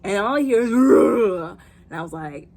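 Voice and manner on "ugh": loud rough growl; mock beast snarl; imitated lion roar. Imitating the lion heard offscreen.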